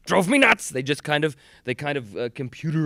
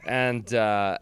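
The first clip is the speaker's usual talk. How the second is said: ordinary delivery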